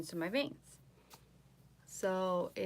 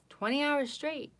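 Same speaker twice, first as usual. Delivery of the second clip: higher, louder "Twenty hours straight"; proud emphasis; 8 semitones higher, 5 dB louder than usual. Real, not acted. Repeats the boast.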